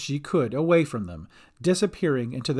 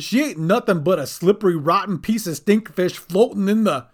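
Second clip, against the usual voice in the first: loud goblin insult, higher; shouting; 5 semitones higher, 5 dB louder than usual. A narrator's reading. Captive Bink insults Dolly, shouting. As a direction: yell it until cut off. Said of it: "he shouted".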